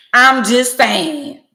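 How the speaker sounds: high, loud and slow; loud drawn-out. Real, not acted. Shouting her catchphrase at viewers, high and slow. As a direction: belt it out, stretching each word.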